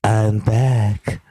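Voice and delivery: Trump impression. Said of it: "It's the ghost of Trump's past".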